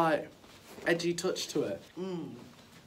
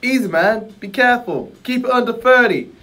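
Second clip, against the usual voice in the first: easygoing genie guru, laid-back; relaxed genie guru; lightly voiced cool guru; faster than usual. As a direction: reassure him like a chill big brother. Acted guru lines.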